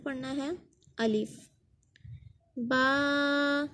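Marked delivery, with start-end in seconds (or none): 2.6-3.7 बा held one alif long; recited long baa; lengthened baa. Shown as a demonstration. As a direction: stretch बा. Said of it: "बा को हम इसलिए लंबा कर रहे हैं".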